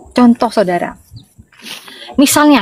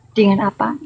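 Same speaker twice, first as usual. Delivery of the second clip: ordinary delivery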